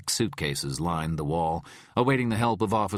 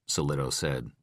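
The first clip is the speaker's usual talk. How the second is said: plain reading voice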